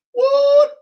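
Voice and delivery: loud, very high squeal of surprise; mock shock. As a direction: squeal it in exaggerated disbelief. Mock shock after his own joke.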